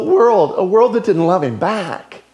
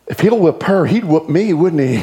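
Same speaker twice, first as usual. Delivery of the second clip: usual speaking voice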